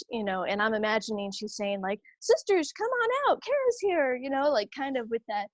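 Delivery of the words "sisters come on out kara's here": high calling-out voice; imitating a nun calling out, much higher; mock yelling. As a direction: call the sisters out of the house. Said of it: "yelling in Spanish".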